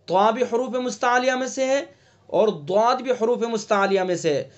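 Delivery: ordinary talk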